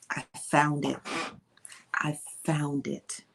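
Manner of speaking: unvoiced slow secret whisper. Real, not acted. Host teasing viewers about the video, whispering. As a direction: breathe it out like a secret. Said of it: "I don't know why I'm whispering".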